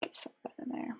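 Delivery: muttering under the breath; half-whispered thinking aloud; quiet narrating. Real, not acted. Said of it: "I stop talking to y'all and start narrating my choices".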